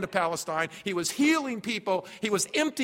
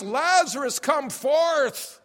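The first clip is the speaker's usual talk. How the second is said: calling out, raised and lifted; preacher voicing Jesus calling Lazarus out, raised; loud call; 6 semitones higher, 3 dB louder, slower than usual. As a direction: call the dead man out of the tomb. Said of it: "Jesus says with a loud voice".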